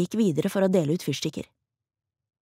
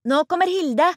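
high, louder excited call; shouted announcement; 11 semitones higher, 5 dB louder than usual. A narrator's reading. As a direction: call out that someone is coming. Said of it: "var det noen som ropte".

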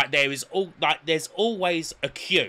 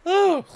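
high, loud groan of revulsion; disgusted ugh; 7 dB louder than usual. Real, not acted. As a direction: recoil from a foul stench. Recalling the hotel's stench.

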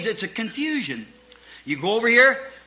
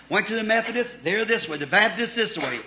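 ordinary talk

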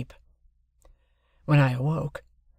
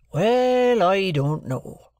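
Joe, hesitant; 6 semitones higher, 3 dB louder, much slower than usual. Acted. Joe voiced in the novel.

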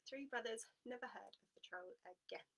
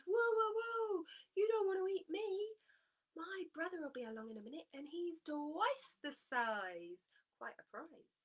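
high, loud pleading goat; 9 semitones higher, 6 dB louder than usual. Acted.

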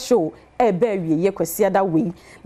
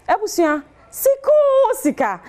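hyped announcement; 10 semitones higher than usual. Acted. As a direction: stretch out sika o sika like a jingle. Host hypes money giveaway.

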